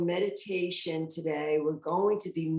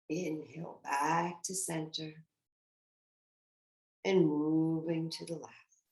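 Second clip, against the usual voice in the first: guided meditation. Acted. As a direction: guide them gently through each breath and sway. Leads a swaying meditation.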